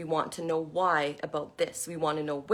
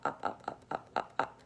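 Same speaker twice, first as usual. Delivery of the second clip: soft, evenly repeated whispered pops; whispery repetition; 9 dB quieter than usual. Shown as a demonstration. Teacher showing balloons floating up and away, whispery. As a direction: repeat it under your breath, like something drifting away.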